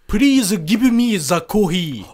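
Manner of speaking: slow, high, loud mock-Japanese English; caricatured Japanese accent. Mocking a coffee order in exaggerated Japanese accent. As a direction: drag out each syllable in caricatured Japanese accent. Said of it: "I'm saying it in a racist accent".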